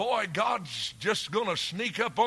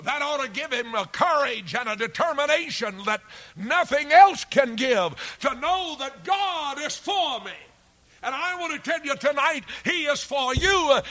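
rising, booming sermon, higher and louder; preaching at full force; 7 semitones higher, 9 dB louder than usual. Acted. Preacher assuring the congregation God is for them, booming. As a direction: drive it home to the congregation.

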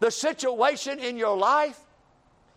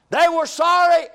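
emphatic accusation; 7 semitones higher, 8 dB louder than usual. Acted. Contrasting fathers with himself.

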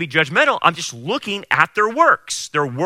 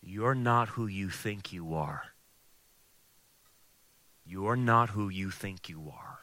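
slow, low, very soft, sinking into a whisper; Satan's insinuation; 8 semitones lower, 12 dB quieter, slower than usual. Acted. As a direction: draw it out as a hushed tempter. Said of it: "and Satan's thing is".

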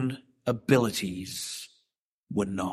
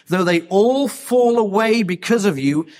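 boastful Peter; 5 semitones higher, 5 dB louder than usual. Retold or imitated. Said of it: "Peter answered him".

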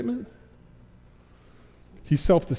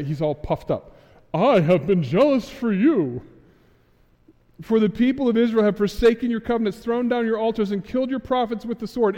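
a self-important, aggrieved complaint, pitched high and pushed louder; puffed up; 5 semitones higher, 4 dB louder than usual. Retold or imitated. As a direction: play the self-pitying prophet, swollen with pride.

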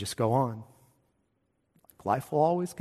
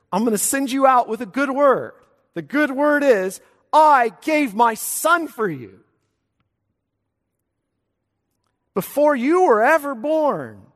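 much higher and louder, fervent preaching; preaching at full force; 10 semitones higher, 7 dB louder than usual. Real, not acted. Pastor proclaiming the good word to his congregation, fervent preaching. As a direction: proclaim it to the whole church, ringing out.

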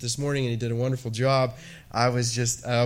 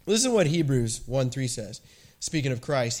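ordinary talk

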